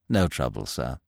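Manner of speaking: low, quiet and deferential; deferential waiter. Acted. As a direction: answer like a humble club servant. Waiter replies.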